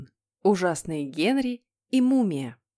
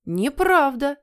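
put-on loud, high childish squeal; tearful squeal; 7 semitones higher, 6 dB louder, slower than usual. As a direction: squeal it as if nearly crying. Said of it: "заверещал Питер, чуть не плача". (визг чуть не плача)

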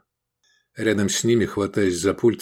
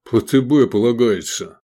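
forced joke; 3 semitones higher, 6 dB louder than usual. A narrator's reading. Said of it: "натужно пошутил".

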